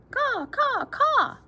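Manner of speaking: high, squawking caw; crow caw. Acted. Crow in the story calling, high squawk. Reading crow's call in story.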